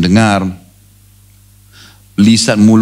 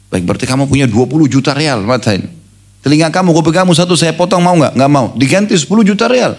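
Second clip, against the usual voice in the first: sheikh's offers, pitched up; 6 semitones higher than usual. Retold or imitated. Sheikh pressing offers, raised pitch.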